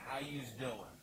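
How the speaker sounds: slow, drawn-out Philly how you's doing; Philly greeting. Shown as a demonstration. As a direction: stretch it like a South Philly local. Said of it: "Here's what it is".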